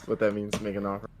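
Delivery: ordinary talk, nothing marked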